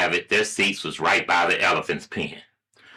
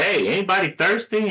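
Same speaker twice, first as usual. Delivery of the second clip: lightly voiced mom, pitched up; higher, casual motherly question; 11 semitones higher than usual. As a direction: call out an offer as a mother. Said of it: "the mother says".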